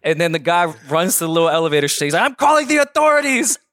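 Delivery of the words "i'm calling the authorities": higher, put-on guard voice; hammed-up threat; mock guard voice.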